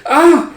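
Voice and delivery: high, loud yelp; short frightened shriek; startled shriek. Acted. Daughter recoils from her father's hand, startled shriek.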